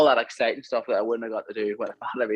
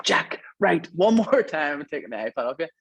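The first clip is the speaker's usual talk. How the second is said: much higher, sharp frustrated dad warning; retold angry warning; 6 semitones higher than usual. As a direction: warn the kid, fed up and escalating. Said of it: "I was shouting at him".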